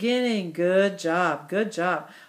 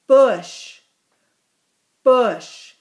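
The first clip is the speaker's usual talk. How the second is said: Bush said louder, firm and falling; emphatic word; 2 semitones higher, 5 dB louder than usual. Shown as a demonstration. Teacher to class, repeating the word with emphasis. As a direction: punch out Bush clearly. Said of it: "And this next word".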